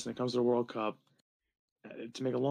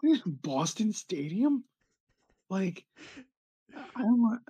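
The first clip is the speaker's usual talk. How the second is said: mock bafflement; 9 semitones higher than usual. Local questioning the name Boston Stadium, mock bafflement. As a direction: play baffled by the name, drawing it out.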